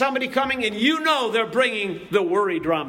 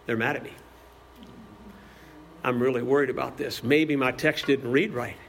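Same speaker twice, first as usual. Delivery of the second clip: anxious inner voice; 11 semitones lower, 5 dB quieter than usual. Retold or imitated. Imagined anxious thoughts over text.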